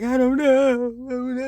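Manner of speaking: high, loud panicky voice; hammed-up panicked friend; mock panic. Panicked quote of Sabrina.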